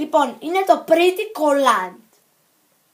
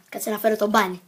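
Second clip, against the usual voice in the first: normal talking voice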